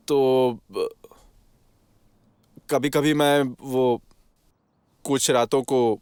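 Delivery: slow confession broken by long pauses; reluctant, halting. Acted. Accused admits brothel visits to the official, haltingly.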